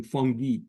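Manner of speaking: "fungi" said as FUN-ghee; third pronunciation variant. Shown as a demonstration. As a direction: end "fungi" on a hard G and an ee sound. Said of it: "we could add a complication to that".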